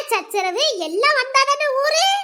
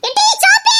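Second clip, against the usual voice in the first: high loud call of the name; 12 semitones higher, 8 dB louder than usual. Acted. Old woman calling Soppi out of the house. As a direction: call her name sharply.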